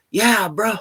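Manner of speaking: light Hawaiian pidgin lilt; imitating Hawaiian homie. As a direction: play a joking Hawaiian buddy. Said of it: "he's from Hawaii".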